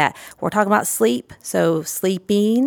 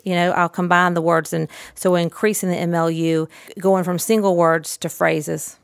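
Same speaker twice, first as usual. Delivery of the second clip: usual speaking voice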